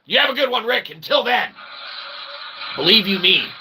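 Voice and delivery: ordinary talk, nothing marked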